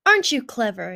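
loud, pleased crow. A narrator's reading. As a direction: crow it with delight. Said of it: "he crowded".

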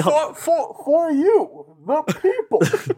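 high mock Bane speech. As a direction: play Bane at a podium. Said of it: "Both doing Bane voices".

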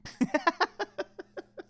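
high, drawn-out laugh; real giggling laugh; amused laugh. Real, not acted. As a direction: laugh at your own dark joke. Laughs at own cat-kicking joke.

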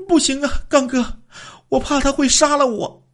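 high, fearful woman's voice; the wife fears her lover will kill her, high and afraid; frightened wife. A narrator's reading. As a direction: protest in fear, voice pitched high.

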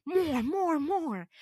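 imitating a teacher egging actors on, high-pitched; hammed-up, insistent urging; mock eager teacher. Retold teacher egging on the actors again.

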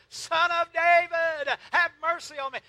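a beggar's full-voiced call to Jesus; crying out. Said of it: "uh, he cried out".

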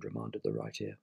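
ordinary delivery

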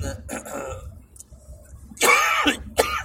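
rattling chest cough; chesty cough. Real, not acted. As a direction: hack it out from the chest. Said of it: "tosse di merda". (tosse di petto grassa)

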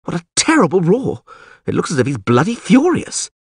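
high, dramatic dismay; alarmed. A narrator's reading. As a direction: exclaim at the terrible roar. Dandelion frightened by the djinn's roar.

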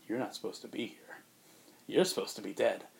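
soft, cold whispered threat; menacing whisper. Retold or imitated. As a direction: hush it like a sinister secret. Imposter threatens real Bruce.

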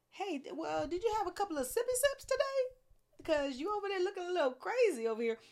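high, fast mock priest voice; mocking Eli. Preacher mocks Eli questioning Hannah, high and fast. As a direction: ham up a nosy priest teasing her. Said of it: "He didn't even ask her".

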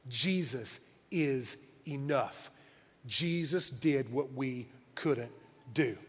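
low, soft, slow and deliberate; quiet, solemn pulpit delivery; hushed sermon close. Real, not acted. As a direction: let each phrase land, with weighty pauses.

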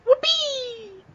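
very high, put-on squeal of "Whoopee!"; squealed cheer. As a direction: squeak it out like a cartoon kid. Co-host echoes the silly cheer.

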